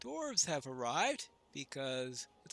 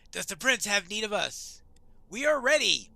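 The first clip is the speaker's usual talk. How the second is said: raised, loud voice of readiness; eager dwarf warrior; 2 semitones higher, 11 dB louder, faster than usual. Acted. Dwarf offers service to the prince, eager.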